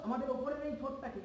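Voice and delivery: ordinary talk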